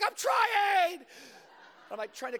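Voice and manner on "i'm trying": high, loud panicked yell; frantic shout. Retold or imitated. Boy stuck in the bathroom shouts back at his friend. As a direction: shout back through the door.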